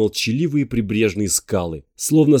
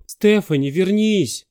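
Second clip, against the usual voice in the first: raised high call after her; shouting; 9 semitones higher, slower than usual. Acted. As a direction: call her back, less force. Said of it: "закричал он".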